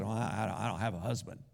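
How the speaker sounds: a hint of an embarrassed woman dodging the question; sheepish mumble. Woman evading Jesus about her husband, low and hushed. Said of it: "She said".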